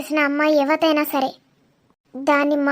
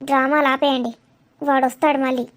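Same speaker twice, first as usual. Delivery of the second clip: ordinary delivery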